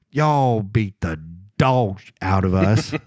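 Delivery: slow, deadpan imitated Southern drawl; matter-of-fact. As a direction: imitate a Southerner admitting defeat, slow and unbothered. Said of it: "just says it so matter of fact".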